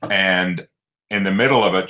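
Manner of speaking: ordinary talk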